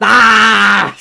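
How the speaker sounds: high, loud yelp of shock; startled cry out loud; sudden shout. Real, not acted. Crying out as the train hits, loud yelp.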